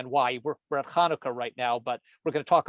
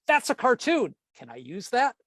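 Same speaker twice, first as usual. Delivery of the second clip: much higher burst on that's a cartoon, then back down; excited blurt; 8 semitones higher than usual. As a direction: blurt out the idea with delight. Himself blurting an idea.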